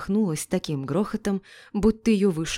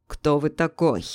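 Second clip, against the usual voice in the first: cold, controlled challenge; icy; 3 dB louder than usual. A narrator's reading. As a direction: ask it with a touch of ice. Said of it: "спросил он ледяным голосом".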